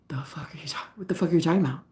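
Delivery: hushed, breathless whisper of shock; whispered disbelief. Retold or imitated. Her to the knife-holding boyfriend, shocked whisper. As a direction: whisper it, stunned and confused. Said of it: "And I was like".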